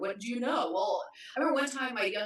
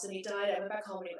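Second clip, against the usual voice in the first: ordinary delivery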